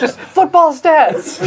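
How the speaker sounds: high-pitched mock shouting; drawn-out, laughing pretend yell. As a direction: shout it like a clueless fake jock. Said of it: "Just go in shouting football stats".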